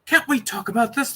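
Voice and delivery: a hopeless plea to be heard; called out hopelessly. Acted. Doctor calling to the soldiers from behind the curtains, hopeless. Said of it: "called out hopelessly".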